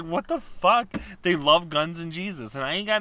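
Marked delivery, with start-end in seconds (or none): 0.0-0.9 higher, louder, bewildered; incredulous. Retold or imitated. Woman retelling her reaction to Florida billboards, bewildered. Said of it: "I was like".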